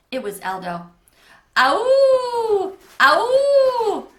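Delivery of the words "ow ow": very loud, high, long howl; hideous howl. A narrator's reading. The Howler answers the campers, hideous howl. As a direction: howl like a monster in the woods. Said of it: "It was so close now".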